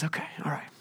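very soft, calming reply; patient God. Retold or imitated. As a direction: answer quietly, letting the complaint pass. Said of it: "And so God responds".

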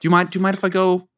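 fast, breezy mock request; rushed mock mom. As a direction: rattle it off carelessly. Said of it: "would just be like".

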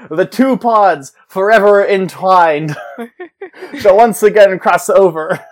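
slow, loud, hammed-up prophecy voice; hammed-up epic announcer; mock-epic proclamation. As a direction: proclaim it like an ancient prophecy, half laughing.

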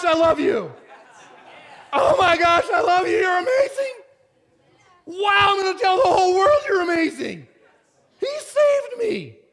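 high, loud joyful shouts, laughing and crying; ecstatic shouting. Retold or imitated. Pastor reliving the day he was saved, shouting with joy. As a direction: shout it out like the floodgates just opened. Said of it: "All of a sudden, I'm like".

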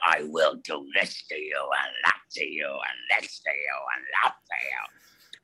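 low, menacing villain growl sinking to a whisper; Corruptor growl. Retold or imitated. Actor demoing his villain role, low growling threat. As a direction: growl threats like a wicked snake-man. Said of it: "growling away at these people".

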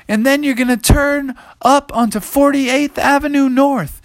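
high, excited hollering; high-pitched urgent navigator; called-out directions. Acted. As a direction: shout directions from a galloping mount. Riders call directions to galloping T-Rex.

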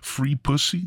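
ordinary talk

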